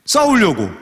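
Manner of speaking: ordinary delivery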